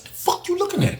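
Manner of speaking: high, hushed challenge; not yelling. Retold or imitated. To the staring dude, a high, hushed challenge. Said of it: "I ain't even yelling".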